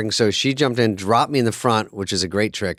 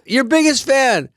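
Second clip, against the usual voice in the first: much higher, slow, fawning; gushing fan; 12 semitones higher, slower than usual. Retold or imitated. Fan at the signing gushing, high fawning mock voice. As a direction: squeal it like a starstruck fan. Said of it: "And they".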